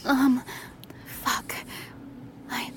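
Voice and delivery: hesitant desire. Acted. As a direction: falter between words, shyly wanting. Shyly voicing a desire when pressed.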